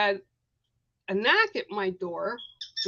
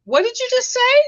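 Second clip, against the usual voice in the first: a sharp, much higher, louder what-did-you-say; incredulous elder; 7 dB louder than usual. Retold or imitated. The elder on the phone, stunned by her refusal, sharp and high. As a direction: pounce on the words in disbelief. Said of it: "And she said".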